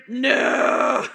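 loud, drawn-out mock scream; guttural scream. Replaying her answer to the unseen priest, guttural scream. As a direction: bellow it long for laughs. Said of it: "This guttural no".

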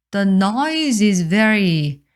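very said very slowly; slow it right down; slow careful teacher reading; slow model reading. Shown as a demonstration. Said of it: "slow model reading".

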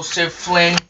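plain reading voice

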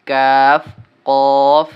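কাফ and কফ said loud, thick and heavy from the throat; loud teacher demo of a heavy কাফ; heavy Qaf. Shown as a demonstration. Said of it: "উচ্চারণটি একটু মোটা করিয়া পড়তে হবে". (মোটা করে কাফ উচ্চারণ)

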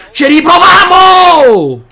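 slow loud rallying cry; shout. Real, not acted. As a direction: drag it out loud. Shouted restart.

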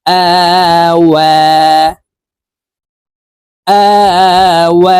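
আওয়া chanted with both vowels long and a rounded w; rounded-lip waw. Shown as a demonstration. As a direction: round the lips for ওয়া in আওয়া, drawn out. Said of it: "ঠোঁট গোল করে দুই ঠোঁটের মাঝে ফাঁকা রেখে". (ঠোঁট গোল করে আওয়া টেনে পড়া)

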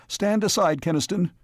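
panicked, high-pitched order. A narrator's reading. Mayor shouting at the man shielding the creature, panicked. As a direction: order him away in rising panic. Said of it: "his voice high with panic".

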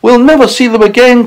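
high-pitched wail of dismay; mock-distressed, fretful lament; crying out. A narrator's reading. Ladybird fearing they'll never see them again, crying out. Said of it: "cried the ladybird".

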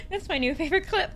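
high, laughing and crying. Real, not acted. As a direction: cry with laughter. Laughing at the clip.

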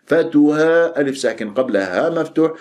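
normal talking voice

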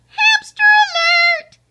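loud, very high-pitched shout; alarm yell. A narrator's reading. Pipsqueak warns the fleeing hamsters, high loud yell. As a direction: sound the alarm at full pitch. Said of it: "Pipsqueak yelled".

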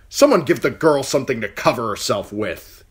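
commanding uncle. Acted. Uncle gives order.

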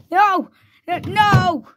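high, loud protesting cries; play-acted panicked yell; mock panic. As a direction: cry out as you're hauled away. Character being put in the drawer.

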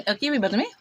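ordinary delivery

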